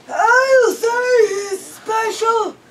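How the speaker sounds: put-on high, loud, slow scoffing old man; snorting grandpa. A narrator's reading. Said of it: "Grandpa Kessler snorted".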